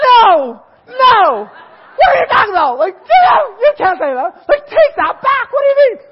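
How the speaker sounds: very high, loud frantic shouting; panicked protest. Retold or imitated. New convert begging the pastor to take it back, panicked. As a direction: yell it in hammed-up alarm.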